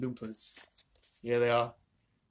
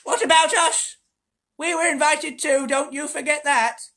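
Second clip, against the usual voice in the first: higher, louder, slow crone voice; indignant old lady; 13 semitones higher, 10 dB louder, slower than usual. A narrator's reading. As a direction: protest like a put-out grandmother. Grandmother protesting from bed.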